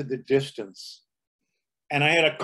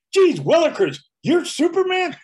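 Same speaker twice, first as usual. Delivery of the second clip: put-on much higher, louder gee-whiz kid voice; Jimmy Olsen; 7 dB louder than usual. Jimmy Olsen gushing at Superman, high and wide-eyed. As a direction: gasp it out like a starstruck cub reporter.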